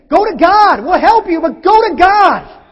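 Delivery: loud, high urging. Acted. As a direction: push them to go to God. Preacher urging go to God.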